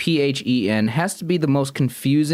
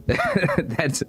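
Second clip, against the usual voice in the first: breaking into laughter mid-sentence; giggly, broken-up protest; laughing; 5 semitones higher, slower than usual. Real, not acted. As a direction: talk through a real laugh, voice lifting. Amused at chat's unhelpful answer.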